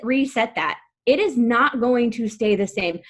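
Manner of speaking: ordinary talk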